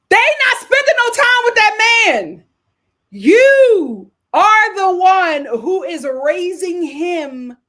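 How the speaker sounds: high, very loud outburst, then slowing for emphasis; scolding shout. Real, not acted. Host telling women they raise these men, scolding shout. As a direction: shout it at the mothers, hammering it home.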